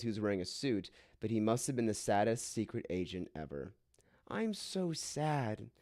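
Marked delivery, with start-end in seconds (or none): 4.3-5.7 crying way. Acted. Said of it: "said Amy in a crying way".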